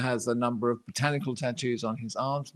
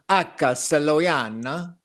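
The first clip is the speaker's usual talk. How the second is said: drawn-out Italian-style plant Latin; slow, lilting Italian pronunciation; Italian accent; 4 dB louder, slower than usual. Shown as a demonstration. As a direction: say it as if you were Italian. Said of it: "should all be talking in Italian accents".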